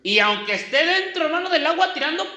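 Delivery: ordinary delivery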